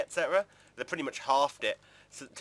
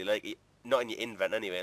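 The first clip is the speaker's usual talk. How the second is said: ordinary delivery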